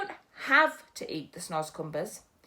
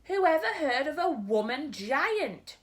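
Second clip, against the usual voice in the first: higher, louder, slow shout; giant's shout; 6 semitones higher, 5 dB louder, slower than usual. Acted. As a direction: bellow it across the cave. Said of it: "shouted the B F G".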